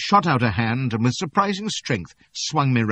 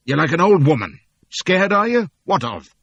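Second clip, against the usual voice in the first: loud, high mocking jeer; scornful taunt; 10 semitones higher, 9 dB louder than usual. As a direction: sneer at his fear. Captain mocking scared narrator.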